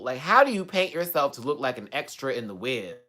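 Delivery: ordinary delivery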